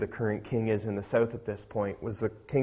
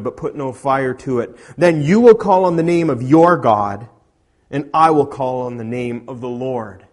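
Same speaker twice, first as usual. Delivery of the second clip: booming prophet's challenge; preaching at full force; 3 semitones higher, 11 dB louder than usual. Acted. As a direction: thunder it out like a prophet.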